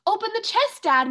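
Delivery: high eager plea to open it; bursting with pride for her dad; excited high call; proud cry. Acted. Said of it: "Melinda cried, full of pride".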